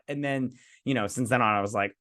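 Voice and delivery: normal talking voice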